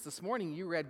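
usual speaking voice

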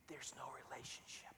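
soft, breathy unvoiced hush; preacher whispering a grave point; whispered. Real, not acted. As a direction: drop to a whisper for weight. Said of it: "dramatic whispered point".

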